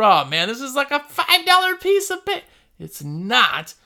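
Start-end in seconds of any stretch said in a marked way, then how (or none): 0.0-2.4 much higher, impressed gasp of "five dollar"; a customer awed by the glossy flyer, voice jumping way up. Retold or imitated. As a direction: play a wowed customer fingering fancy cardstock. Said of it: "People assume".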